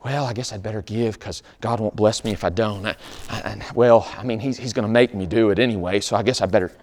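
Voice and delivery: soft, hesitant, resigned mumble; reluctant giving. Retold or imitated. Someone giving only out of fear of God, soft resigned mumble. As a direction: talk yourself into it unwillingly. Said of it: "Or of necessity being".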